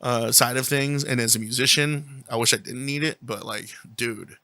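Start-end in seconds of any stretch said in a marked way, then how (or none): none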